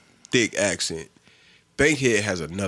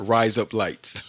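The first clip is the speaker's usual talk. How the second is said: "Rise up lights" drawn out, falling at the end; slow mock Aussie; slower than usual. Shown as a demonstration. Friend repeating the funny phrase slowly. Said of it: "have them say".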